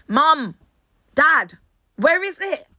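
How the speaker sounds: high, loud "Mom, Dad, where is it?"; mock plea to parents. Daughter calls out to her parents, high mock plea. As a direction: playfully demand the missing genes. Asking parents for dance genes.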